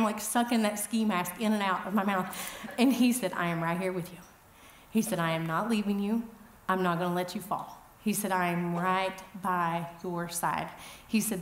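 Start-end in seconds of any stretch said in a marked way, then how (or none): 3.3-10.7 a hint of soft, lower reassurance; soothing a child. Friend calming her on the mountain: lightly voiced soothing tone. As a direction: talk her down gently like a scared child. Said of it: "talking to me like I'm a child".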